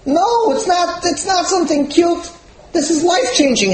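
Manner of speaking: high, stretched-out protest; emphatic objection. Acted. Rabbi's emphatic rejection, acted.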